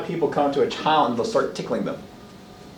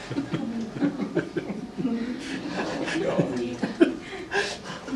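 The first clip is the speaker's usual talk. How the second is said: soft laugh; 7 semitones higher, 9 dB quieter than usual. Real, not acted. Laughs after parent story.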